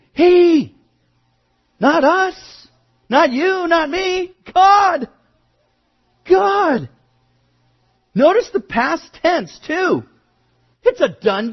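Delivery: high, forceful preaching shout; emphatic preaching. Acted. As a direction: drive it home with full force. Preacher hammering the point.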